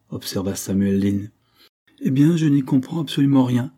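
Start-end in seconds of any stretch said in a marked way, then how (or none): 2.0-3.7 louder, higher, mildly exasperated outburst; brusque. A narrator's reading. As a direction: cut in brusquely, voice raised. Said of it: "brusqua monsieur Dare". (ton brusque)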